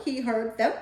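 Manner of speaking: ordinary delivery, nothing marked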